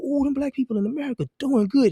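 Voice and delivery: impressed mock praise; mock admiring gush. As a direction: coo over them like a sudden fan. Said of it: "the world started going".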